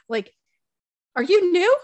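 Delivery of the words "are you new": high, loud mock indignation; exasperated parent. Mother insists to defiant child she's boss, exasperated. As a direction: pull rank in hammed-up disbelief.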